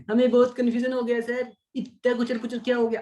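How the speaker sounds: high-pitched mock whine of confusion; confused student. Teacher acting out students muddled by the working, mock distressed. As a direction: complain like an overwhelmed pupil.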